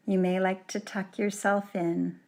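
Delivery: usual speaking voice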